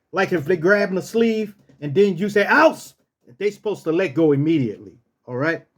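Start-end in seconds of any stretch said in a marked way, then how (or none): 2.5-2.9 high, sudden order to let go; raised dog-handler command; sharp command. Shown as a demonstration. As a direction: call the dog off firmly. Demonstrating a release command.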